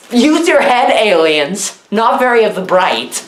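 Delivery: ordinary talk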